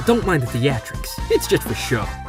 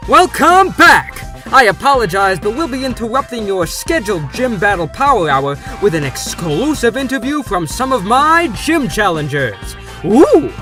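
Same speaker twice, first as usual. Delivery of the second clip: announcer shout; 6 dB louder than usual. Acted. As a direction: burst back on air. Host returns from break on air.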